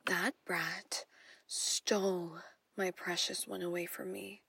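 ordinary delivery

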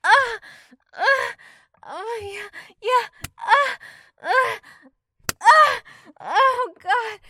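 very high, very loud cries of pleasure; climax cries. Acted. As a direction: cry out at full pitch as you peak.

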